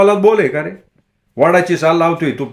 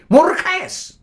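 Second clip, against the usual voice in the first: much higher sudden shriek-like question; madwoman's cry. A narrator's reading. Bhagi startling Sunya, much higher sudden cry. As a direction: snap it out high at him. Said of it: "तशी ती ओरडली".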